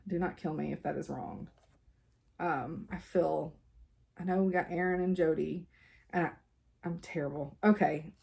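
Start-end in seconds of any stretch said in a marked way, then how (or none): none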